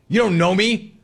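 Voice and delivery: hammed-up annoyed customer; mock indignant. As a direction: toss it off as mock outrage. Mock complaint to friendly staff.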